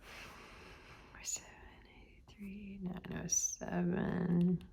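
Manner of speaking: barely audible, slow whisper; whispered to self. Real, not acted. Double-checking the data alone, whispered. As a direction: breathe the figures out slowly, just for yourself.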